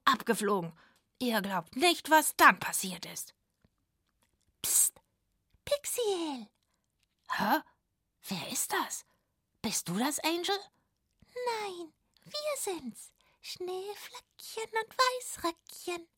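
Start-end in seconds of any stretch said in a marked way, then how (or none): 4.6-10.6 soft, whispered back-and-forth; hushed. Acted. Pixiel whispering with someone hiding nearby. Hushed exchange with hidden callers. 11.6-16.1 much higher, much softer, slow little voices; tiny snowflakes. Acted. Snowflake sisters softly introducing themselves to Pixiel.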